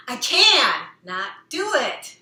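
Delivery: "I can not do it" loud and slow with "can" and "not" split apart; stress on can and not. Shown as a demonstration. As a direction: hit "can" hard, pause, then "not". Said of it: "it's how you say it".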